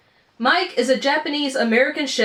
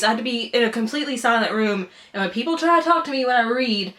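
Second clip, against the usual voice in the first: usual speaking voice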